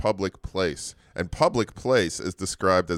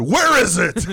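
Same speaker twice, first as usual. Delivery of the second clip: laughing mock yell, higher and louder; mock shouting; 10 semitones higher, 9 dB louder than usual. Billionaire yelling at his butler over chicken, laughing mock shout. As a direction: bellow it through laughter like a spoiled billionaire.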